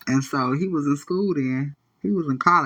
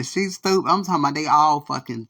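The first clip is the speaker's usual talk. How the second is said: ordinary talk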